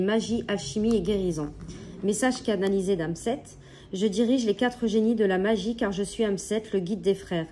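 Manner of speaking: booklet reading. Acted. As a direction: read the oracle's message like a solemn announcement. Reads card text from booklet.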